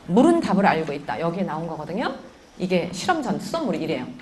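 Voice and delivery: ordinary delivery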